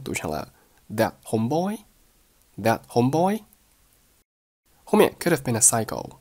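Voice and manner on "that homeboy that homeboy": weak "that" with unreleased t, "homeboy" rising; rising tune on homeboy. Shown as a demonstration. Teacher demonstrates intonation, "homeboy" rising at the end. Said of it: "homeboy语调上扬".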